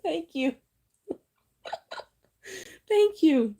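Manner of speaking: high, giddy thank you through laughter; breathless laughing thanks. Real, not acted. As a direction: thank them while still laughing. Still laughing after emotional moment.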